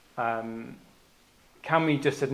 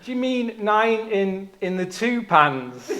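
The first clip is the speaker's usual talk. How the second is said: high, slow, careful questioning imitation; lightly mocked careful query; Patrick checking; 7 semitones higher, 5 dB louder, slower than usual. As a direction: spell it out like a patient colleague. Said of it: "then Patrick was like".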